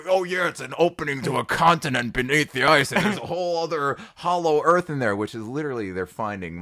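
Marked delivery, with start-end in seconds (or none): 0.1-5.1 mock conspiracy voice.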